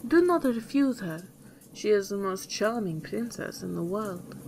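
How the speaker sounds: ordinary delivery, nothing marked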